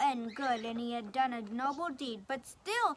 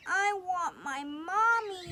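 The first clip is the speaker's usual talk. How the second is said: high slow little voice; tearful duckling; 6 semitones higher, slower than usual. Acted. Duckling wanting his mommy, small tearful voice. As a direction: whimper it like a small child. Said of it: "he said".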